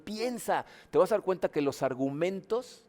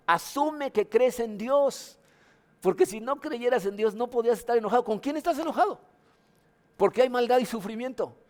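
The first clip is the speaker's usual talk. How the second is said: louder, higher preaching push; fervent preaching; 7 semitones higher, 5 dB louder than usual. Acted. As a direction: drive the point home from the pulpit. Preaching with rising force.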